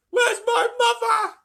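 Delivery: very high, loud, slow bawl. A narrator's reading. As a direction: bawl it in despair. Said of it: "bawled Missus Bucket".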